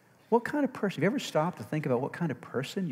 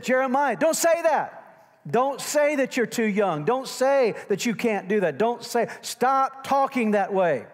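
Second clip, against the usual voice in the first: loud, high, drawn-out rebuke; God sternly telling off Jeremiah as a parent would; scolding like a parent; 11 semitones higher, 6 dB louder, slower than usual. Acted. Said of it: "When you're talking to your kids, how do you say that?".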